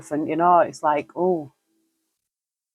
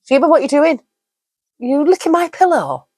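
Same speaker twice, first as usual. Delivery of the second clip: high, loud sing-song talk to the cat; high playful scolding of the cat Sheba; high pet voice; 11 semitones higher, 4 dB louder than usual. Real, not acted. Talking to her cat.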